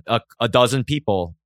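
ordinary delivery

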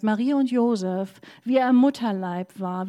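normal talking voice

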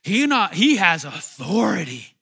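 loud, rising wonder; amazed outcry. Retold or imitated. Disciples marveling at Jesus calming the storm, loud awe. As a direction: proclaim it, stunned by his power. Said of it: "them going".

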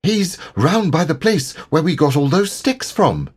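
high-pitched small creature voice; anxious little animal piping up; small, high character voice; Piglet. A narrator's reading. Piglet speaks up to Pooh.

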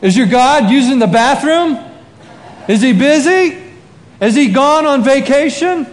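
high, loud mock questions; acted jeering call-out; taunting yell. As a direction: heckle rivals across a distance. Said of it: "he yells out to him".

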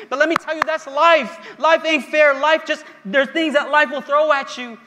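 mock despairing rant growing loud; retold anger. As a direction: voice her anger, building to a cry. Friend's angry outburst retold by pastor.

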